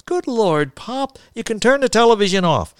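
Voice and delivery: high-pitched protest to Pop; insistent son correcting his father; exasperated arguing. Acted. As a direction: argue back, voice pitched well up.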